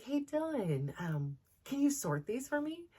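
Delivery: put-on high, gentle asking a child; sweet teacher voice. Teacher asking Dylan to sort the animals, high gentle voice. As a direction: coax the kid sweetly.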